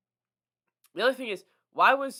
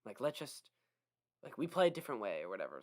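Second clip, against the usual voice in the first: monotone voice; 6 semitones lower, 7 dB quieter than usual. Retold or imitated. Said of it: "she had a monotone voice".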